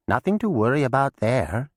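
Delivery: high, dismissive witch voice; Mab, dismissive. A narrator's reading. Mab waves off the groan, high and dismissive. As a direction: brush off the danger.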